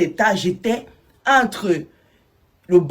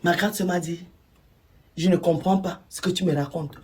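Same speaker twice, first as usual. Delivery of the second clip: ordinary talk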